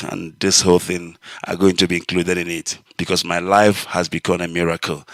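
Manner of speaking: ordinary talk, nothing marked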